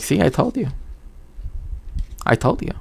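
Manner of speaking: a hushed told-you-so, lightly voiced; soft mock mother's told-you-so; mom's I-told-you. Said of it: "my mom was like".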